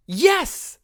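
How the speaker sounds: high, loud burst of joy; excited yell. Acted. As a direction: shout it out, thrilled at big news. Acts out Carol's pregnancy reveal.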